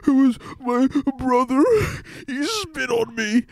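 high, gasping wail; mock tearful child. Six-year-old tattling on brother to parents, wailing. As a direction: blubber it slowly, hammed-up and high.

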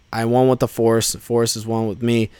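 soft, subdued retold chant; hushed mantra. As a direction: drop the voice and recite it like a quiet mantra. Quoting a Force mantra solemnly.

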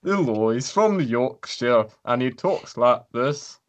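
slow, clumsy attempt at a Yorkshire accent; a shaky go at a Yorkshire accent; a failed Yorkshire accent that drifts toward Scottish. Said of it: "He's got a Yorkshire accent".

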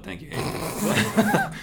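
slow mock thanks; drawn-out sarcastic thank you. Real, not acted.

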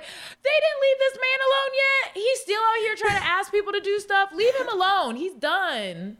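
high, pleading mock voice; mock pleading for Obama. Teasing the ad, high and pleading. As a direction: playfully beg them to let him rest. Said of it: "I was like".

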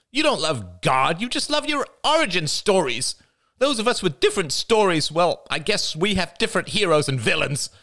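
high, bitter, trembling accusation; venomous passion. A narrator's reading. Jada confronting the family, bitter high outburst. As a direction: let the hurt curdle into venom. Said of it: "Her voice trembled with venomous passion".

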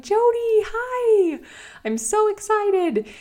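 awkward, very high and loud squeal of a greeting; super high-pitched fangirling. Retold or imitated. Greeting an idol in the crosswalk, super high-pitched and awkward. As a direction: imitate your own overexcited fan squeal, drawn out at the end. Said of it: "super high-pitched voice".

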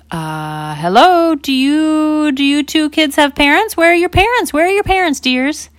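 high, sweet surprised lady voice; startled lady. Acted. Lady in car greeting lost toddlers, high and sweet.

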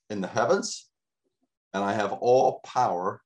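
ordinary talk, nothing marked

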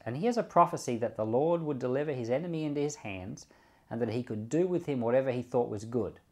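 normal talking voice

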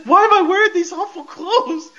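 mock panicked high moan; crying and moaning. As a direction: whine it like a terrified man waking from a spell. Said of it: "sounded like Paul Bearer with all the crying and moaning".

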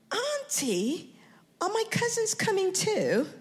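put-on high child's question. Nephew asking his aunt about cousins, in a high child voice. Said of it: "his kids".

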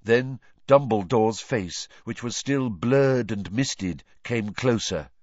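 ordinary delivery, nothing marked

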